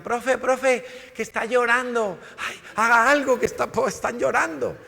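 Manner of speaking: put-on high, loud, urgent children's cry for help; alarmed kids calling. Children alerting their teacher that a classmate cries, high and loud. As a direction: call out like worried kids tugging at the teacher. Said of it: "Y todos estos decían".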